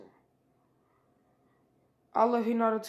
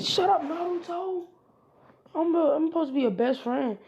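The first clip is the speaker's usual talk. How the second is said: higher, louder, slowed-down yell; angry outburst; 8 semitones higher, 7 dB louder than usual. Acted. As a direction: snap back at him, hurt and furious. Sasuke yells at Naruto.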